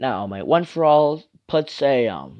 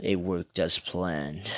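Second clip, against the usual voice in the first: low, slow, soft smirk; understated evil satisfaction, pitched down; smirking villain; 7 semitones lower, 9 dB quieter, slower than usual. Acted. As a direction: say it slowly and quietly through a smirk. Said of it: "Evil Deku then smirks, saying".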